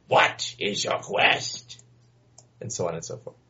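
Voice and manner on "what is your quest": slow, rough old man impression; old questioner. Imitating the old man who asks questions, slow voice. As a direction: draw it out like a crazed old man. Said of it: "the old guy that just laughs maniacally".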